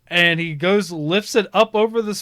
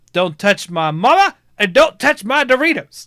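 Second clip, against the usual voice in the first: loud, higher mock-stern kid voice; scolding little kid; 6 semitones higher, 7 dB louder than usual. Kid warning mom's date off his Doritos, scolding child voice. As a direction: lay down the law like an indignant child. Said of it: "and then he goes".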